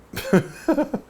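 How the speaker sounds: high, breathless unvoiced laugh; a quick amused puff of laughter; airy giggle. Real, not acted. Showing an old eight-track to viewers: a high airy giggle.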